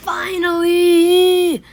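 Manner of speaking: long drawn-out triumphant yell; ecstatic shout. Real, not acted. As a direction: stretch it in triumph. Relief after long grind.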